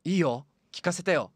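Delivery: childlike patient, lightly voiced; a lonely boy asking to chat.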